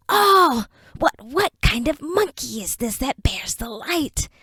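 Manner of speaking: a sudden high gasp, then a whispered sneer; creature whisper. Acted. Dark creature whispering to Enoch about the light-bearing monkey. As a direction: hiss the question from the dark.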